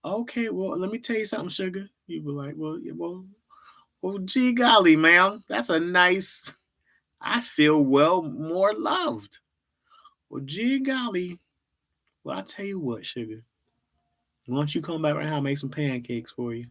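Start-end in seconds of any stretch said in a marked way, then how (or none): 0.1-1.9 put-on country accent. Imitating a Southern charmer to a stranger, mild Southern country accent. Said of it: "somebody hit you with a country accent". 2.6-16.7 high, hammed-up Southern country accent, slowed; bad country accent. A bad Southern country impression for the chat. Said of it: "I don't do accents well".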